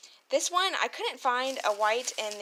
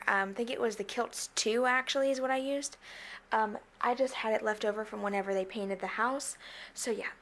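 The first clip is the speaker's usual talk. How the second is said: ordinary talk, nothing marked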